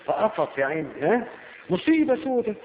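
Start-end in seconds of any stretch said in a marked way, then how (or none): 1.7-2.5 mock panic. Voicing the frightened defendant.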